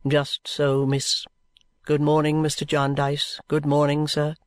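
low, measured, solemn lawyer voice; a gaunt lawyer speaking with slow self-righteous gravity; lawyer Vholes. A narrator's reading. Lawyer Vholes assures the guardian, low and solemn.

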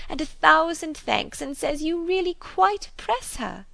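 high, gushing spinster, swooping pitch; fulsome, overwhelmed gratitude; effusive chatterbox. A narrator's reading. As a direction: overflow with thanks and excitement.

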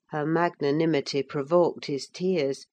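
usual speaking voice

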